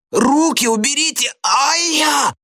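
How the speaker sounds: very high yell into a pained yelp; Gradusov yelling. A narrator's reading. Pupil yanked by the ear by teacher, yelling. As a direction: scream as if dragged by the ear. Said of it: "заорал Градусов".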